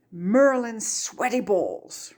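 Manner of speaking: slow, exasperated muttered curse; slow, weary exasperated oath. A narrator's reading. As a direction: grumble it in disbelief. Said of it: "Remus muttered".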